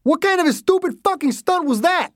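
high, strained incredulous yell; angry scream. A narrator's reading. Driver to the girl after near crash, screaming. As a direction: scream the question in outrage. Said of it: "the man screamed".

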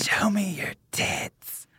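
soft slow ghostly whisper. Retold or imitated. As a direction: breathe it like a ghost. Imitating heard ghost whisper.

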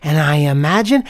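put-on sincere kid sharing his secret; kind student's voice.

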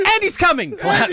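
high, loud toy warning cry; mock alarm. Toy warning the others of Andy, mock alarm. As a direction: call out the warning in playful panic. Voicing toys collapsing, as in Toy Story.

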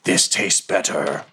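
slow, hissed ghoul voice; hissing ghoul. Pretend. Ghoul to the ranger it just bit, hissing slowly. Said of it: "It kind of hisses something".

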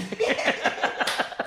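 high breathless laugh. Real, not acted. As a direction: burst out laughing at your friend. Laughing at co-host.

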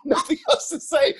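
words laughed out up high; laughing. Real, not acted. Retelling a short phone call, laughing. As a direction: laugh through the line.

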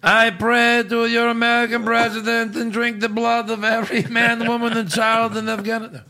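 put-on high-pitched Bulgarian accent; hammy Borat-style Bulgarian accent. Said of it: "doing a Bulgarian accent".

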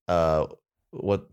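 ordinary talk, nothing marked

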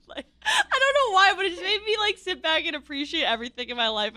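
high, loud, half laughing, half crying; laughing through tears. Real, not acted. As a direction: voice cracking with real emotion, laughing at herself. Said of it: "I'm gonna start crying".

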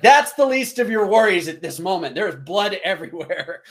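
high, loud mock-exasperated outburst; exasperated retort. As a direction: re-enact an incredulous retort, easing into amusement. Acts out exasperated reply to friend.

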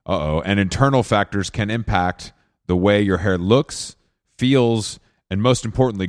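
soft, even sponsor read. Acted. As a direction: read the ad copy smoothly. Host reads sponsor ad.

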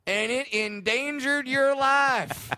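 hammed-up, stretched stand-up cadence; Jeff Foxworthy. Guest improvising dwarf jokes on request, slow high impression. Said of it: "But in Jeff Foxworthy".

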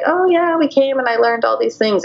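high, breezy mock enthusiasm; sing-song imitation of a rosy tale; mock cheerful boast. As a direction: chirp it like a proud success story. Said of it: "people are like".

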